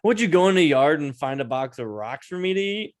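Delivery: sarcastic mock question. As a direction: sneer it at mom. Mocking mom's cereal.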